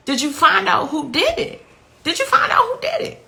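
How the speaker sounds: insistent, high-pitched retold questioning; retold urgent demand. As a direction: press the officer for an answer, pitch rising. Reliving urgent question to officer.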